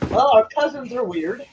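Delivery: ordinary talk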